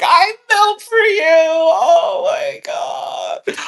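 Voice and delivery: very high, loud starstruck squeal; drawn-out high fan scream; fanboy squeal. Retold or imitated. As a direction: gush at full pitch like a thrilled superfan. Acts out gushing fanboy reaction.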